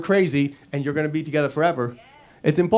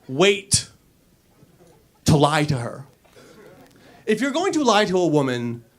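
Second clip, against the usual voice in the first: normal talking voice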